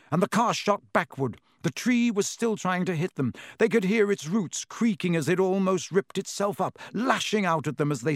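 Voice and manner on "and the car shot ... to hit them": ordinary delivery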